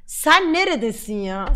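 usual speaking voice

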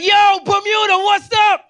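high, loud, friendly shouting; hammed-up gang guys cheering him; excited gang greeting. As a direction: greet him like an old buddy. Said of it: "So they're like".

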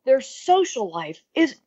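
high, slow exasperated dad; mock-dramatic quote of the brother; imitating brother. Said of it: "he went".

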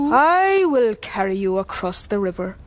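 smiling fox. A narrator's reading. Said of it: "he said, smiling".

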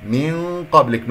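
من قبلك with a dark nasal hum before the qaf; slow recited من قبلك; heavy ghunna. Shown as a demonstration. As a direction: hum the noon of من heavily into قبلك. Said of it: "نون قاف غنة مفخمة".

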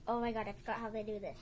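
usual speaking voice

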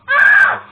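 real high scream of fright; loud startled shriek; frightened scream. Real, not acted. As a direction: scream at the jump scare. Jump scare in the game.

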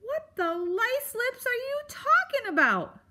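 very high, exasperated outburst; baffled demand. A narrator's reading. Porcupine baffled by the begging mouse, exasperated. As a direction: demand it in cranky disbelief. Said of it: "Aerith asked".

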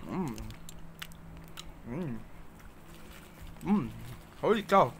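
chewing chocolate; talking while eating; mouth full. Real, not acted. Seen 0: Holds chocolate throughout; bites at 7.1, chews during final words.

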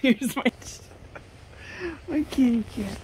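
high, loud, gushing at the cat; cooing at her cat, high and loud; excited pet voice. Real, not acted. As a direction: show off the kitty with squealing delight. Showing her cat on camera.

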